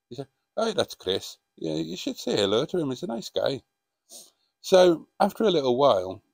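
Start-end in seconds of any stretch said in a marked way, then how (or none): 0.6-3.6 friendly Scottish barman; Scottish accent. Acted. Barman tipping off a customer about Chris, in a Scottish accent. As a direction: say it in a Scottish accent, pitch lifting after the first words. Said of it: "Shall I do a Scottish accent".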